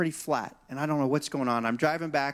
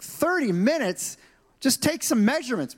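incredulous protest; 8 semitones higher than usual. Retold or imitated. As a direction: balk at the wait, pitch leaping up. Acted disbelief at the wait.

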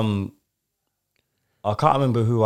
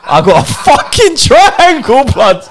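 high, slow outraged yell; indignant shout; 8 dB louder, slower than usual. Real, not acted. Friend yelling about his bad Secret Santa gift, high slow shout. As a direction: shout it out in disbelief.

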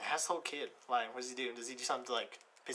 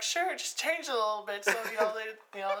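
high-pitched voice granting a favor; acted-out reply; 8 semitones higher, 3 dB louder than usual. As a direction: play the friend answering, pitched up. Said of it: "It's like".

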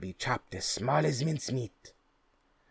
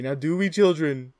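mock scolding; 7 semitones higher, 11 dB louder than usual. Mock-stern address to children.

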